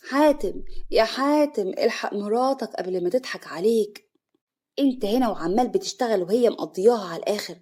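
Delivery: ordinary delivery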